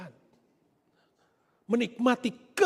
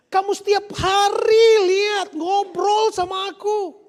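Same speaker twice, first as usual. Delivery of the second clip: loud, higher and slower, pleading; fatherly shout; 8 semitones higher, 8 dB louder, slower than usual. Acted. Father urging his elder son to see, loud and pleading. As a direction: plead with him at full voice.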